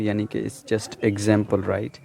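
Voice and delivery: normal talking voice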